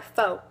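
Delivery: "fo" for four, no R at all. Shown as a demonstration. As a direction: end "fo" on a pure o. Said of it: "four" sound like".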